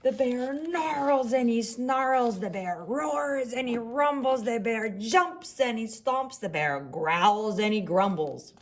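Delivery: growly bear narration. A narrator's reading. Reading to children, growling as the woken bear.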